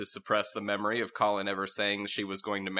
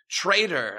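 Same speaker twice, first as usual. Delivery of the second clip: loud, higher angry heckle; crowd shout; 9 semitones higher, 6 dB louder than usual. Acted. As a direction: yell it from the crowd. Court shouting at Frosh.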